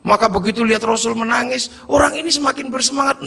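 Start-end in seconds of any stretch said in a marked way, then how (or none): none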